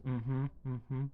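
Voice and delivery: imitating her son. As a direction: mimic the boy's unsure hum.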